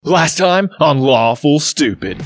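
slow dramatic recap intro; previously on recap. A narrator's reading. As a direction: announce the recap slowly. Recap intro over music.

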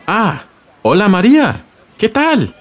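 cheerful greeting. Acted. Greets Maria at door.

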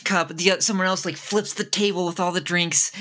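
high, breathless scene of chaos; excited narration. Acted. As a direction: rattle it off in wide-eyed alarm.

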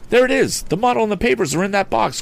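put-on very high, excited young hero; crying out. A narrator's reading. Tom sights the stolen box, high excited cry. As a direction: call it out with pitch way up. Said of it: "cried Tom".

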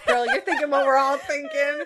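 high, loud, laughing sass; hammed-up sassy friend. Acted sassy quote with laugh.

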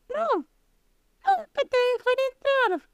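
high, startled piglet cry; frightened little pig. A narrator's reading. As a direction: squeal it out in fright. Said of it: "se despertó asustado y respondió". (cerdito asustado, voz aguda)

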